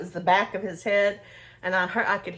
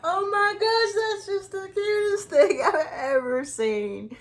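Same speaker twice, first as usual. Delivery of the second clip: high, laughing gush; much higher, laughing and near tears with delight; 12 semitones higher than usual. Retold or imitated. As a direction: melt over the cutest thing. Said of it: "I'm like".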